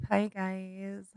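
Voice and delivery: sheepish hushed hello; awkward greeting. Real, not acted. Co-host greeting listeners after awkward moment, sheepish and soft.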